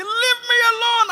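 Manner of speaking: hammed-up high shout of protest; mock yell of a struggling patient; loud, high mock protest; retold shout. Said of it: "The man was shouting".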